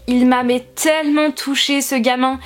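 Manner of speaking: normal talking voice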